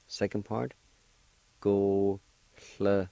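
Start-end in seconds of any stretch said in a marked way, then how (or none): 1.6-3.1 slow syllables. Shown as a demonstration. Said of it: "is pronounced like this".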